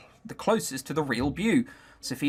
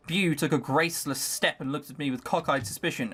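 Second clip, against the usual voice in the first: ordinary delivery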